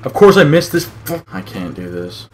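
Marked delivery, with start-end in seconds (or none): 0.0-1.2 loud, high, cut-off curse; exasperated outburst. Real, not acted. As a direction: burst out cursing your own miss. Player curses own miss.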